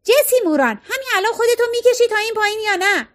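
high, fast shriek like a siren; shrieked summons. A narrator's reading. Fiona yelling for Jessie to come down, piercing shriek. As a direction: screech it out in one high rush. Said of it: "بعد جیغ زد".

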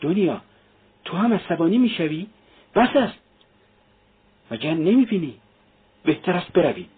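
high-pitched, alarmed mother; horrified. A narrator's reading. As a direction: plead anxiously in a high voice. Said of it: "مادر با وحشت".

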